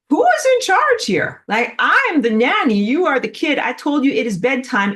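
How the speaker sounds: high, loud mock scolding; exasperated nanny. Nanny putting kids to bed, mock indignant. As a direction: lay down the law as a nanny. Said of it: "When you're a nanny".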